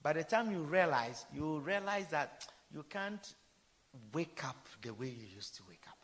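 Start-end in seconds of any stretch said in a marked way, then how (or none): none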